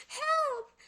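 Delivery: shrill high cry for help; panicked high shout; scream for help. A narrator's reading. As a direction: scream it, terrified. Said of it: "screamed Annie".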